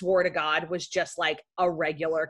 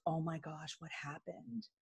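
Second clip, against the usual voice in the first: hushed question; 3 semitones lower, 18 dB quieter than usual. Retold or imitated. Classmate leans over whispering.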